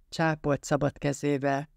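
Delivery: plain reading voice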